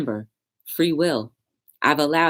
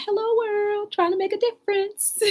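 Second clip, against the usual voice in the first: much higher bright announcer-style hello to the world; cheery greeting. Retold or imitated. Speaker playing herself greeting the world, high and cheery. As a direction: sing it out like greeting the whole world. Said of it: "I'm like".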